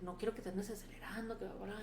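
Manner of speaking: soft, quick imitation; lightly voiced quiet placating; retold soothing. Imitating Luis after the fight, soft and quick placating. Retold partner calming her down.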